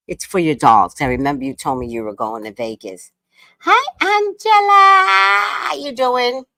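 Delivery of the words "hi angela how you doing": high, loud, drawn-out hello; excited greeting. Real, not acted. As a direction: call out a long happy welcome. Greeting viewer on livestream.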